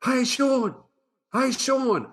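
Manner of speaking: high, loud retold call down a hill; imitating a schoolboy hollering at the new kid; yelling out. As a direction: call his name from up the hill like the cool kid did. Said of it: "yelling out".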